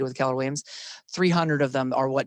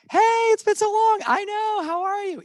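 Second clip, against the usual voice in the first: much higher, loud, gushing hello; bubbly greeting; 7 dB louder than usual. Shown as a demonstration. Agent calling an old friend, gushing high greeting. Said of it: "talk to them just for a brief few minutes".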